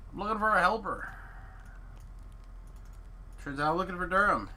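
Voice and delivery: high, hammy quest-giver voice; goofy high voice. Pretend. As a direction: ham it up like a game character. Voices the in-game helper line.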